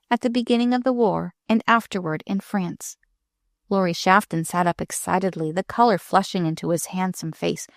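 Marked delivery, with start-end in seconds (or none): none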